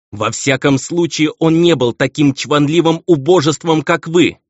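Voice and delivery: high, loud ringing retort; ringing, indignant exclamation. A narrator's reading. Baroness insults her husband as pompous, ringing indignation. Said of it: "звенящим голосом воскликнула леди".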